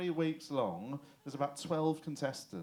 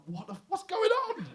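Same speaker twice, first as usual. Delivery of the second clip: high, loud mock panic; comic startled shout; alarmed outburst; 6 dB louder than usual. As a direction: yelp in hammed-up bewilderment. Acts out alarmed viewer reaction.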